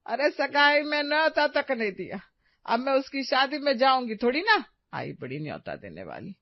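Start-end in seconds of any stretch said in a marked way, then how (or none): 4.9-6.3 scornful mutter. Acted. Mother-in-law scorning neighbour.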